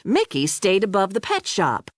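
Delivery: usual speaking voice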